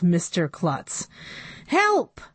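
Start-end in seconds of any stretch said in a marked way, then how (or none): 1.7-2.2 high panicked cry; yelled. A narrator's reading. Board president flees the robot, high panicked yell. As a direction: scream for help. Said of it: "yelled Doctor Carbles".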